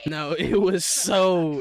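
ordinary delivery

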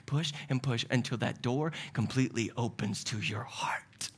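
hushed, breathy whisper. Acted. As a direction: whisper it like a secret. Whispered devil's plan.

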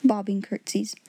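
ordinary delivery, nothing marked

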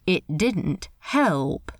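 plain reading voice

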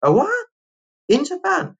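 disbelieving officials, high and shrill; incredulous. Retold or imitated. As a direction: officials mock-shocked at the answer. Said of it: "They're like".

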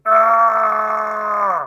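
hammed-up high loud scream; mock the cheesy dubbed scream. Imitating the father's dubbed scream from the movie, hammed-up loud scream. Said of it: "the father's English dub screams are like".

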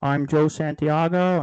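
slow, loud podcast-host impression with stretched words; Joe Santiago impersonation. Opening the show as the absent co-host, mock impersonation. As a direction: mimic the absent host, drawing out the greeting, pitch climbing. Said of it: "that's my best Joe Santiago impersonation".

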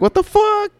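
very high-pitched incredulous squeal; retold stunned reaction; shocked disbelief. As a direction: exclaim it way up high, about to laugh. Retold shocked reaction, breaking into laughter.

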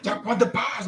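high-pitched, acted exasperation; mock fed-up outburst; exasperated telling-off. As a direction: act out a kid who has had enough. Acts out telling off classmate.

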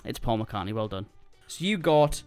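normal talking voice

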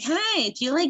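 put-on high, sweet little kid voice; like a child. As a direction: ask it like a small child. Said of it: "go around this like a child".

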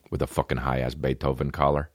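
ordinary delivery

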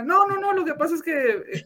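protesta aguda y defensiva; sentido, ofendido. Real, not acted. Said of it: "está, está sentido". (protesta sentida, en tono agudo)

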